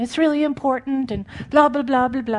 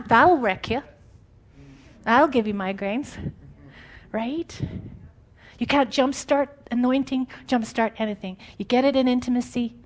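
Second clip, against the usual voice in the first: ordinary delivery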